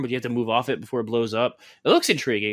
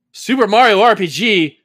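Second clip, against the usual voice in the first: loud, higher-pitched pretend surprise; hammed-up indignant disbelief; mock astonishment; 6 semitones higher, 7 dB louder than usual. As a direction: act startled by an unexpected find. Mock staffer finds a good game.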